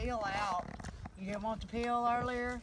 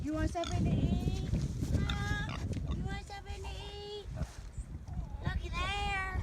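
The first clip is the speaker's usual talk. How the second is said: high coaxing pet voice; sweet high voice coaxing a pet; squeaky praising a dog; 10 semitones higher than usual. Real, not acted. Coaxing animal with food.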